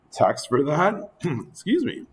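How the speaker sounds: losing voice, hoarse; strained hoarse voice. Real, not acted. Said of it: "Losing my voice here".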